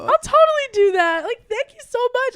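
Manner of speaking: high and loud, gushing; excited high voice. Retold or imitated. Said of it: "And I said".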